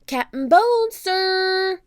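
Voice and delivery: high, loud call; shout. Acted. Jack shouts for the pirate captain. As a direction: call across the ship. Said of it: "he shouted".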